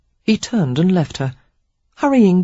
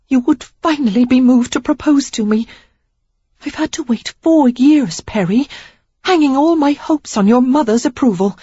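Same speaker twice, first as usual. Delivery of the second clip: put-on young woman's voice, pitched up and heated; higher, louder reproach building to a burst; Sarah, indignant; 8 semitones higher, 4 dB louder than usual. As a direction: let her hurt boil over at him.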